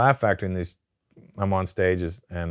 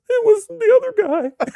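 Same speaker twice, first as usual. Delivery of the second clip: very high, loud strangled squeal; mock a choked guy's squeaky plea; choked man pleading; 7 dB louder than usual.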